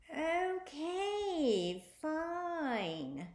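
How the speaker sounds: high, drawn-out creepy neighbor; grudging witchy old lady. A narrator's reading. Neighbor agrees, voiced figure.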